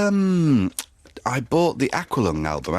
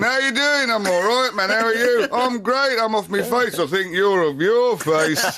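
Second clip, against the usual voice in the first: higher, louder dazed rocker voice; 12 semitones higher, 3 dB louder than usual. Retold or imitated. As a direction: trade lines like a spaced-out rock star. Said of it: "the real [name] sort of walked out".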